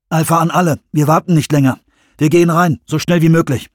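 lauter, bestimmter Funkspruch; Funkkommando. Acted. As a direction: entschlossen ins Walkie-Talkie befehlen. Funkspruch ans Team. (Jones gibt dem Team per Funk laut den Befehl)

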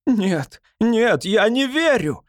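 high, shrill-edged hysterical cry of denial; hysterical yell. A narrator's reading. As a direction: scream it in disbelief, losing control. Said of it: "истерично заорал Фёдор". (истеричный крик)

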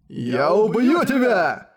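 slow, high, weak growled threat; unconvincing growl. Acted. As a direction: snarl it without conviction. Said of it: "неубедительно прорычал Дюгалд".

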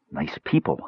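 low, soft muttered aside; under her breath. A narrator's reading. Brandella remarking to Tanis on the pair, under her breath. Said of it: "said under her breath".

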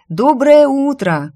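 high, loud, drawn-out good morning; cheerful greeting. A narrator's reading. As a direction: sing out a smiling greeting. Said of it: "поприветствовала я его и улыбнулась". (радостное громкое приветствие с улыбкой)

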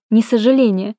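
usual speaking voice

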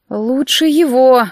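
slow, sullen retort; gloomy objection. A narrator's reading. Friend prefers the captive be taken, gloomy objection. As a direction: object glumly, drawing it out. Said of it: "хмуро возразила Ройл".

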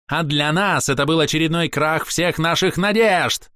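ringing cry of despair; theatrical shout. Acted. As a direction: proclaim it grandly. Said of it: "Закричал Костель, картинно".

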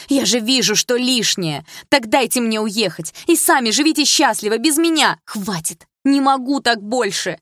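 high loud desperate shouting, slow; outburst. A narrator's reading. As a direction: let it all burst out. Daughter breaks down shouting. (дочь кричит в отчаянии)

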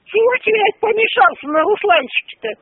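usual speaking voice

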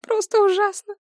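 put-on high, whining lament of an old mother; self-pitying mother. A narrator's reading. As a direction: plead high and pitiful. (высокий жалобный голос матери, себя жалеет)

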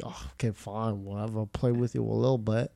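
a tired, reluctant okay; grudging. Retold or imitated. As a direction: sigh and give in grudgingly. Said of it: "I'm like".

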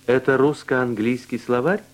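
ordinary delivery

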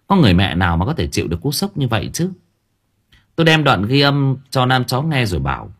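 ordinary delivery, nothing marked

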